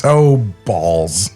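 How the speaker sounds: usual speaking voice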